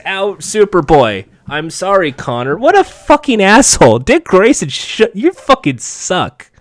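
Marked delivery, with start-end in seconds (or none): 2.6-6.5 high-pitched, indignant rant; indignant cursing. Real, not acted. Viewer disgusted by Dick's betrayal of Superboy, indignant cursing. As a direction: curse him out in a raised pitch.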